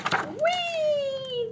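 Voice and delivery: long, very high gleeful squeal; whee. Acted. Animals flying through the air, high happy squeal.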